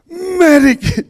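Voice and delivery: high, loud yell for the medic; retold yell. Burned soldier in the helicopter yelling for the medic, high and loud. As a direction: shout out in pain for help. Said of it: "And I yelled".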